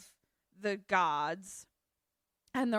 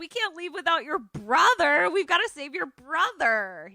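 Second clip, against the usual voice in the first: high, loud excited outburst; 7 semitones higher, 6 dB louder than usual. Retold or imitated. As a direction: act out breathless eagerness to rescue someone. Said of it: "He's so excited".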